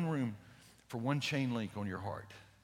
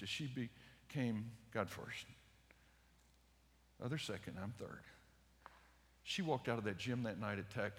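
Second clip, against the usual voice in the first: softer, lower, tender preaching voice; hushed sermon; 4 semitones lower, 7 dB quieter than usual. Acted. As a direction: bring the room down into quiet awe. Hushed close after the climax.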